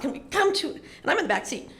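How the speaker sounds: normal talking voice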